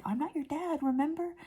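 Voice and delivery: put-on high-pitched reminder to a child; imitated parental correction; gentle correction. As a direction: remind a toddler, voice lifted. Retelling his correction of child.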